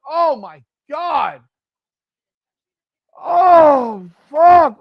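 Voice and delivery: high, slow, loud cry of despair; anguished groan. Real, not acted. Fan watching a missed layup, anguished loud cry. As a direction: moan it out in agony.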